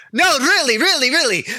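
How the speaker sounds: high, pleading denial; pleading protest. Acted. Bird protesting to the guard, high and pleading. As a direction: insist on innocence in a high voice.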